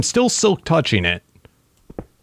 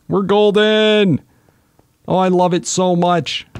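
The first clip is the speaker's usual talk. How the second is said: delighted; 6 semitones higher than usual. Real, not acted. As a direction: savor the good news.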